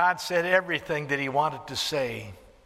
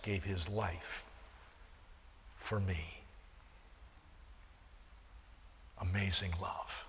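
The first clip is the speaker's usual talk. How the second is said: soft, slow awed prayer; a quiet hymn-like meditation on the cross; hushed worship; 5 semitones lower, 9 dB quieter than usual. Real, not acted. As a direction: breathe it out in quiet wonder.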